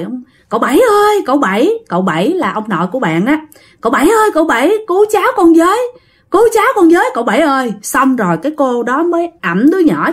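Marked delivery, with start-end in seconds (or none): 0.5-1.7 frantic calling out. Retold or imitated. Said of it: "cổ la quá trời". 3.8-7.7 frantic calling out. Retold or imitated. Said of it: "cổ la quá trời".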